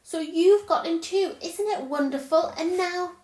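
high and loud, bubbling over; a girl thrilled her brother found the magic land; excited. A narrator's reading. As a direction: gush with wonder. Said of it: "she cried".

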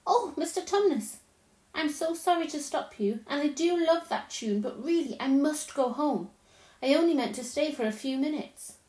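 politely anxious to be off; apologetic Lucy. Acted. Lucy tells the faun she must go home, apologetically.

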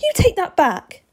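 high, loud angry demand; yelling. A narrator's reading. Steve demanding the vampire retract it, yelling high. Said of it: "Steve yelled".

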